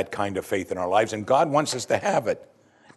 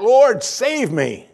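high, loud and slow plea; desperate cry; 11 semitones higher, 5 dB louder, much slower than usual. A narrator's reading. Sinking Peter crying to Jesus for rescue, desperate high plea. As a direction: cry out as you sink. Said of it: "he cried out".

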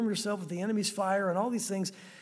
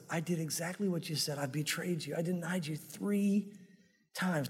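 broken confession; 4 semitones lower, 6 dB quieter than usual. Acted. Peter to the Lord, a quiet, crushed confession. Peter realizing his betrayal.

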